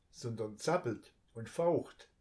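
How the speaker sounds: usual speaking voice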